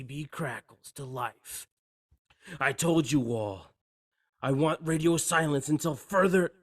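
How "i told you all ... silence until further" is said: sharp, raised voice, losing patience; angry command. Acted. Hans scolds his men over the CB, angry raised voice. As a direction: bark the order down the radio.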